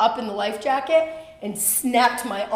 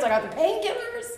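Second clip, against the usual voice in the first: swooping up very high; comic list climax; 11 semitones higher than usual. Acted. Listing her stash of medication, playful high-pitched flourish.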